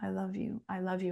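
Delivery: hushed, gentle, repeating it softly; soft repeated affirmation. Retold or imitated. Mother softly guiding her daughter through affirmations, hushed. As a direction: coax a child with a quiet repeated mantra. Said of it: "Just say this with me".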